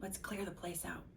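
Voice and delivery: very soft, hushed burglar voice; burglar's whisper. A narrator's reading. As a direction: breathe it so no one wakes. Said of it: "whispered the first burglar".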